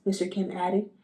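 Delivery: ordinary delivery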